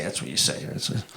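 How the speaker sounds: whispered under the breath; whispered aside. Real, not acted. Whispered repeat.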